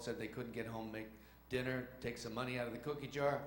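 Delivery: usual speaking voice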